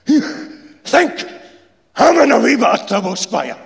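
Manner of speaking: loud, high sneering mimicry of the threat; mocking repeat. Insulator mocking the foreman's threat back at him, loud sneer. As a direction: throw his words back at him with scorn. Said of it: "He mockingly repeats the foreman's threat".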